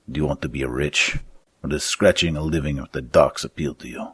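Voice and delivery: put-on fat schemer with a thick Romanic accent. Fat man recruiting two thieves, thick Romanic accent. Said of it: "asking in his thick Romanic accent".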